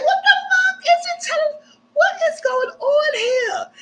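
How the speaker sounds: high, slow, sobbing and shouting with laughter; mock crying shout. Narrator mimicking the crying villa, high sobbing shout. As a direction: ham up a tearful meltdown.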